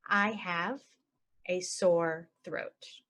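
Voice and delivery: very slow, broken into chunks. Shown as a demonstration. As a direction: draw it out for learners. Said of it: "normal then slow".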